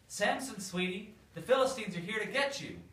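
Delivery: high, loud mock alarm; calling out alarm. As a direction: shout the warning up high, a bit hammed-up. Delilah calls out an alarm.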